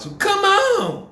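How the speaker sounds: high, loud, drawn-out impatient yell; mock scream. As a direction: imitate the auntie yelling impatiently.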